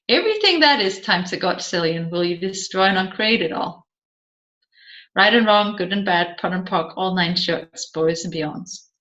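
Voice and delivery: a practised incantation, quick then trailing away; rapid clearing statement. Acted. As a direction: rattle off the formula fast, fading to a low murmur.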